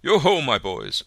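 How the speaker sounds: loud, high, hearty calling out; fat jovial voice. A narrator's reading. Fezziwig calling his apprentices, loud and jovial. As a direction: boom it out like a jolly old master. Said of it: "jovial voice".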